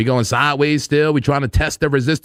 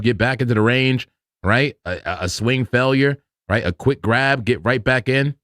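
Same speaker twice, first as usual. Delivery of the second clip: ordinary talk, nothing marked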